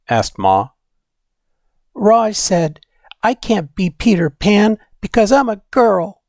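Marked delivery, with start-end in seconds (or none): 1.9-6.2 put-on higher, childlike voice telling Ma her hurt; upset little girl. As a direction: play a young girl complaining to her mother.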